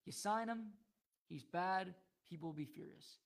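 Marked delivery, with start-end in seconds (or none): 2.3-3.2 whispered aside, voice gone. Real, not acted. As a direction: drop it to a hushed whisper. Parallel outcome, dropped to a whisper.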